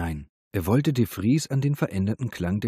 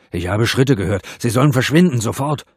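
very loud, rising angry shout; furious, agitated yelling; bellowing; 4 semitones higher, 10 dB louder than usual. A narrator's reading. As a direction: roar an order to get out. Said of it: "brüllte de Vries".

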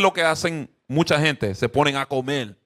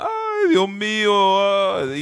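long, drawn-out mock wail of grief; mock telenovela wailing; 3 semitones higher, much slower than usual. Preacher parodies telenovela mourners when someone dies, slow mock wailing. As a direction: wail like an overdramatic soap mourner. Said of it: "y están llorando, llorando, llorando".

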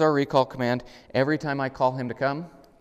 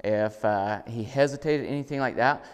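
ordinary talk

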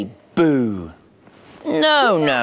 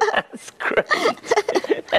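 high, laughing praise. Real, not acted. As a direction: say it through delighted laughter. Laughing after being startled by boo.

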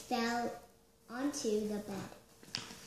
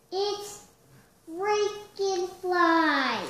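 high, loud, drawn-out cry; 7 semitones higher, 8 dB louder, slower than usual. A narrator's reading. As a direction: cry out the monster's name in alarm, stretching it. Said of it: "Buzz cried".

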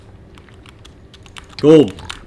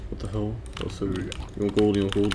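normal talking voice

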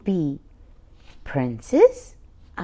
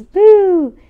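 playful boo; 8 semitones higher, 10 dB louder than usual. Acted. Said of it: "Daddy says".

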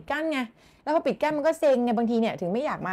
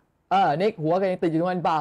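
teasing; 4 dB louder than usual. Shown as a demonstration. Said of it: "เพื่อนมันก็เลยแซวอย่างเงี้ย".

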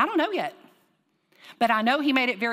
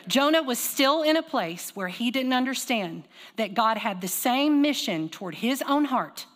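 slow, deliberate restatement; slow repeated emphasis; 2 semitones higher, slower than usual. Shown as a demonstration. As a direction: say it again slowly, leaning on "same". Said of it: "I'm gonna say it again".

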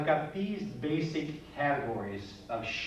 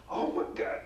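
breathless whispered Oh my God, imitating a scared kid; teacher imitates a startled kid gasping Oh my God in a whisper.

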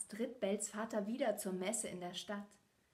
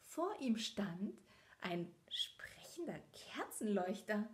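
plain reading voice

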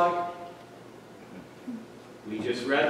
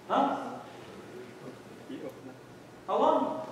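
normal talking voice

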